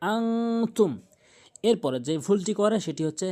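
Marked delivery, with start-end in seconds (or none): 0.1-0.9 "আংতুম" with the n held humming in the nose; higher, long nasal "আংতুম"; nasal ghunnah. Shown as a demonstration. Teacher showing ghunnah, "আংতুম" hummed through the nose. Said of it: "গুণনা করবেন".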